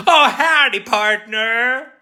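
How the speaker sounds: high, loud hammed-up American cowboy drawl; American accent. British streamer showing viewers an American accent, hammed-up and loud. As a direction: belt out a mock American howdy, stretching it. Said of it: "I can do an American accent".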